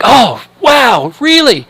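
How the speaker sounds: high, loud, amazed "oh wow, really?"; amazed gasp. Retold or imitated. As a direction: gush with wide-eyed surprise. Tourists amazed by the companion.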